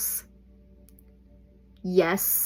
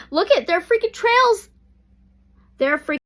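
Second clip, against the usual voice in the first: high, loud irritated outburst; real irritation bursting out, much higher and louder; exasperated outburst; 11 semitones higher, 8 dB louder than usual. Real, not acted. Reading the cards to viewers, she snaps about sky trails, loud and exasperated.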